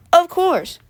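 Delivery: a hint of a high robot helper; little robot. Little robot replies to Abe.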